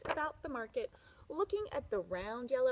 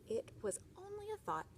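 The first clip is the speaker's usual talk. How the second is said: meek wife; 10 semitones higher, 5 dB quieter than usual. Acted. Meek wife replies to grouch.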